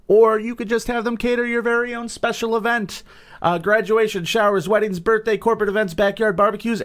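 high, sing-out sponsor pitch; ad read. Real, not acted. Host pitching catering to listeners, high upbeat ad read.

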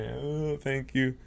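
slow, drawn-out tearful thanks; mock bawling. Hypnotist retells thanking a little girl, mock bawling. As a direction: imitate crying while saying thanks. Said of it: "just bawling".

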